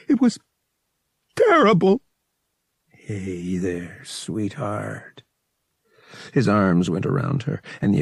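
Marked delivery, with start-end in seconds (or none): 0.1-2.0 much higher, trembling, near sobs; between chattering teeth. Acted. Bria tells Vic her hurt, high and trembling. 2.9-5.2 tender. Acted. Vic tenderly comforts Bria.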